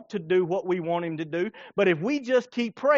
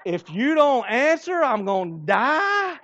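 mock pleading prayer; 4 semitones higher, 4 dB louder than usual. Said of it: "It's like".

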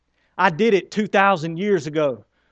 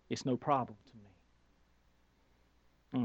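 slow soft reassurance; 6 semitones lower, 14 dB quieter, much slower than usual. Acted. God voiced, reassuring the believer.

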